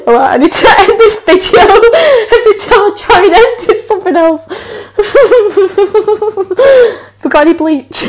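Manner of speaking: giggling while talking; breaking character giggling. Real, not acted. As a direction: laugh through the words, can't stop. Laughing through attempts.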